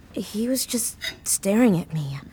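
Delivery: hushed, as if he could still hear; frightened whisper. Acted. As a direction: drop to a scared whisper on staring. Frightened Tanya recalls stalker.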